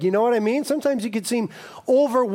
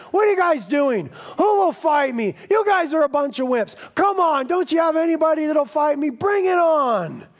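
Goliath taunting; 6 semitones higher than usual. Retold or imitated. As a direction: call them out like a bully giant. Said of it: "he shouted openly at Israel".

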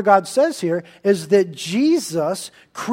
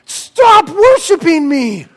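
shocked angel refusing worship; angel protesting; 8 semitones higher, 7 dB louder than usual. Acted. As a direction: cry out in disbelief, much higher. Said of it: "the angels would say".

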